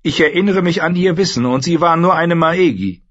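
high, quick queen's voice; brisk and bitter; young queen. Acted. Dany recalls the maegi, high and quick.

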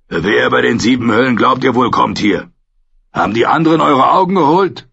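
barsch und lauter, am Ende höher und schneller; schroff. A narrator's reading. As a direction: der alte Bär raunzt die Wachen an. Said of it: "erwiderte nur schroff". (schroffe, laute Zurechtweisung)

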